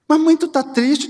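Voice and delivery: put-on a high, surprised child's question. Child asking mother if she is sad, surprised. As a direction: ask like a small child caught off guard.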